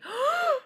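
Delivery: loud, high dramatic gasp; shocked gasp. Pretend. Listeners react to the secret.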